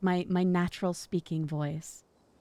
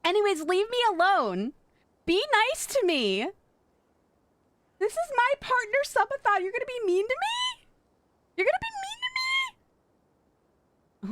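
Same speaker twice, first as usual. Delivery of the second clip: hammed-up whiny pout; mock whine; 14 semitones higher than usual. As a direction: whine like a wounded child, playful. Mock whiny playful complaining.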